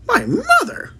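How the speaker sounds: high, startled cry of disbelief; cried out. A narrator's reading. Giant astonished at Sophie's question, crying out in high surprise. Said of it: "cried the B F G".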